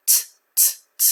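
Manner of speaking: "t" as repeated voiceless taps; unvoiced t. Shown as a demonstration. Teacher tests the t sound, unvoiced "t" repeated. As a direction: tap out "t" with air only, not the letter name. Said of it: "when the consonant is unvoiced".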